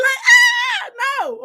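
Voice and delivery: very high shouted ah; comic shriek. Retold or imitated. Joseph shrieking as he flees the wife. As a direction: yelp and bolt.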